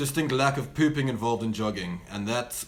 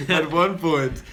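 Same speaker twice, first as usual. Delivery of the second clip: laughing through a mock threat; giggling as she quotes the shotgun line; 8 semitones higher, 7 dB louder than usual. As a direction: repeat the threat while cracking up. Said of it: "Oh my word".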